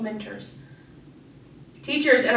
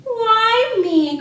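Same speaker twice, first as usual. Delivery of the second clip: high, loud storybook wail; hammed-up plaintive cry; 11 semitones higher, 8 dB louder than usual. A narrator's reading. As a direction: cry it out as the hard-done-by beaver. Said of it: "cried the younger beaver".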